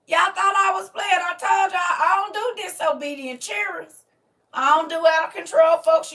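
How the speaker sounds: high, loud, slowed, mock-stern praise; proud loud preaching. Real, not acted. Teacher shouting praise at her former hellions, loud and high. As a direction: scold them proudly, full voice. Boasting to class.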